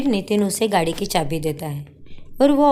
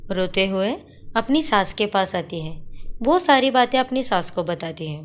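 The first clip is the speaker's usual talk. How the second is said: ordinary delivery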